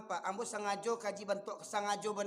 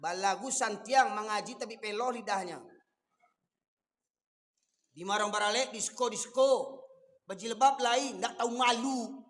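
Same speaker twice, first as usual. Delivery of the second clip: louder, scornful preaching; scolding sermon; 7 dB louder than usual. Real, not acted. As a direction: lash out at the shameless. Preacher scolds elders loudly. (ceramah memarahi dengan lantang)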